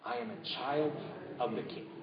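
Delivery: hushed, soft whispered affirmation; breathy congregational whisper. Shown as a demonstration. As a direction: whisper it together, eyes closed. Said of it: "whisper that with me".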